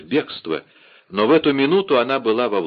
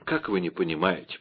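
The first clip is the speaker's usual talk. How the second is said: low, soft mock wonder; understated fake astonishment; feigned surprise; 4 semitones lower, 6 dB quieter than usual. A narrator's reading. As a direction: pretend to be puzzled, softly. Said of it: "притворно удивилась миледи".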